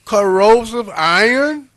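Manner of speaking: loud, high, disbelieving; incredulous outrage. Real, not acted. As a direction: raise it up in disbelief. Host incredulous about rusting steel.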